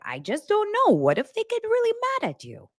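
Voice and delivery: high-pitched worried mom impression; fretting mom. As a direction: fret like an anxious mother, voice way up. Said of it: "She's like".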